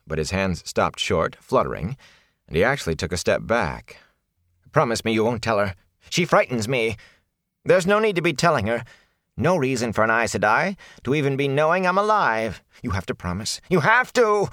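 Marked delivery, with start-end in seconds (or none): 4.7-14.5 desperate pleading. Acted. Pleading in fear.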